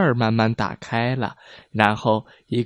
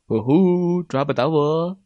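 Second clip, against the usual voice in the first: teasing call; 3 semitones higher than usual. A narrator's reading. Hide and seek game.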